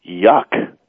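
ordinary talk, nothing marked

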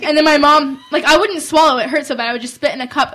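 ordinary talk, nothing marked

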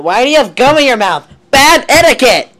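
mock scolding.

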